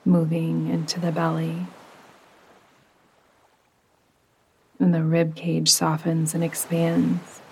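calm, unhurried meditation voice with long pauses; soothing yoga nidra narration, evenly paced; guided meditation. Acted. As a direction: guide the listener gently down into rest.

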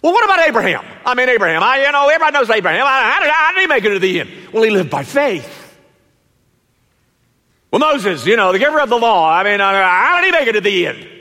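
high, loud, rushing questions; eager questioner. Acted. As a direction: pepper him with quick excited questions. Questioner again, more excited.

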